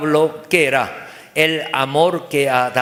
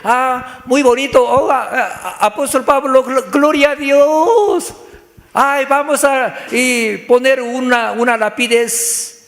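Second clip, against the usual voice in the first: high, loud mock admiration; hammed-up enthusiastic churchgoer; gushing listener; 7 semitones higher, 4 dB louder than usual. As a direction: overdo the praise, stretching the exclamations. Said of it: "escuchamos ese mensaje".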